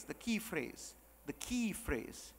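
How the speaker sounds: very soft, teasing repetition; hushed build-up. Shown as a demonstration. Speaker priming the audience for the key phrase, hushed. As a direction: drop right down, as if sharing a secret.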